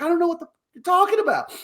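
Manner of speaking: fast, high-pitched mock protest; exploding argument. Boss denies everything to angry girl, high rushed protest. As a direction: squeal out a hurried denial. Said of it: "it's, like, exploding".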